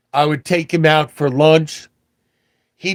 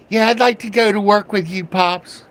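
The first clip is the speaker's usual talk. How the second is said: put-on eager kid; 5 semitones higher than usual.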